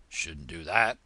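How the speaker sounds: low, slow grumbling frog; muttering frog. Acted. Frog scolds Alice, low grumble. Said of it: "the frog muttered".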